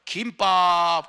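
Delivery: a street seller calling out kimbap; short clipped vendor call. Shown as a demonstration. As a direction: call 김밥 short, don't stretch it. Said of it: "김밥은 뻗으면 안 돼".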